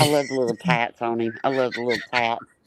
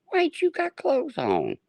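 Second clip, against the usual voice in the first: a much higher, mocking squeal. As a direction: squeak it out to mock him. Mocking Craig shirtless.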